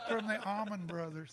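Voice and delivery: loud laughter mid-name; laughing. Real, not acted. Teller laughing loudly as he reveals the name.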